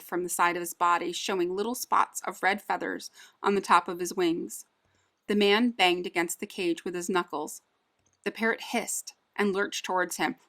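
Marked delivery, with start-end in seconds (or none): none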